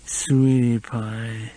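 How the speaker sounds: very slow slurred words. A narrator's reading. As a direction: slur it out drunkenly. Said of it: "Her speech was slurred".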